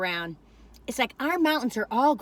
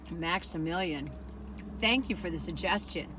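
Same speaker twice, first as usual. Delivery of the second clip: low satisfied thanks; 7 semitones lower, 7 dB quieter than usual. Real, not acted. Savouring the bite, thanking viewer.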